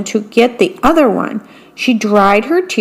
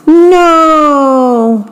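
long, high falling wail; drawn-out storyteller's scream, higher but only slightly louder; mock scream; 6 semitones higher, 3 dB louder than usual. A narrator's reading. Mickey sees her teacup fall, screaming a drawn-out no. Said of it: "Mickey screamed".

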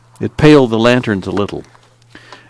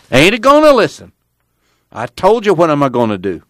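high, louder cranky protest; stubborn refusal; 7 semitones higher, 3 dB louder than usual. A narrator's reading. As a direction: wave off the plea. Grandpa keeps refusing.

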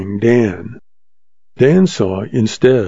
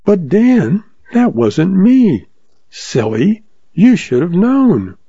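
amused June; 8 semitones higher, slower than usual. Acted. June laughing him off.

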